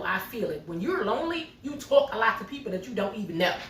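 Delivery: ordinary talk, nothing marked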